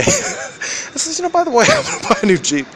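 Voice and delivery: laughing real hard while quoting. Real, not acted. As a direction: giggle the line out. Laughing through a retold remark.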